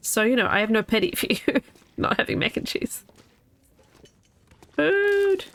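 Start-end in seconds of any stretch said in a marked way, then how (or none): 4.8-5.5 high, delighted single word; excited find. Real, not acted. Hungry gamer spots food, delighted high call. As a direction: sing out the find.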